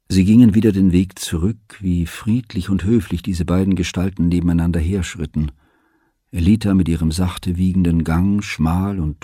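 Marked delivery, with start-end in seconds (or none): none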